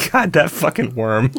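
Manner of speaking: ordinary talk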